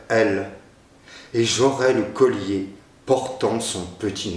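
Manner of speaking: measured recital of a sonnet, unhurried; poem reading. Acted. Teacher reciting the sonnet for his students, measured poem reading. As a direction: read the verse aloud with care, a slight pause at each line.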